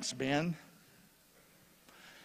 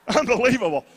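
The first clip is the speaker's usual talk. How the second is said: laughing disbelief; 4 semitones higher, 6 dB louder than usual. Real, not acted. Vikings fan disbelief.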